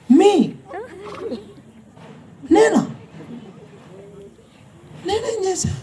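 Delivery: high, startled disbelief. Retold or imitated. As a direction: squeak it out in shock. Man startled at being blamed. (ఆశ్చర్యంగా, గొంతు పైకి లేచి)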